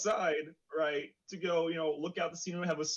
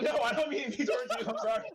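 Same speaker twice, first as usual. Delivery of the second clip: high, laughing mock apology; 9 semitones higher than usual. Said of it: "I'm just like".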